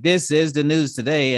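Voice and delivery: newscaster voice. Retold or imitated. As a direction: read it like a TV anchor. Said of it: "they all sound like".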